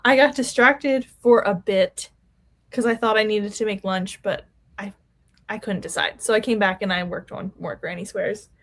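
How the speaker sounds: normal talking voice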